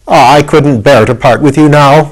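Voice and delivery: ordinary delivery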